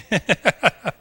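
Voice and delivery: loud high laugh; high burst of laughter. Real, not acted. Preacher laughs at his little-brother voice, loud laughter. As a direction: crack up at your own impression. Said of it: "preacher laughs at own impression".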